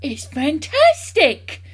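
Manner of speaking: slow, high, loud exclamation; excited shout. A narrator's reading. Mister Cranky dancing round the hen, excited shouting. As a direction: shout it in wild delight, stretching the word. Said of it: "Mister Cranky shouted".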